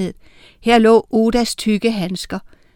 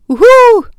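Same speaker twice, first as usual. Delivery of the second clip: loud, high owl call; high, sustained "uhu"; owl hoot; 11 dB louder than usual. A narrator's reading. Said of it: "tudede Uline".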